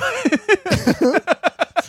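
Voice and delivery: squeaky high-pitched laugh; high giggle. Real, not acted. Laughing after the joke.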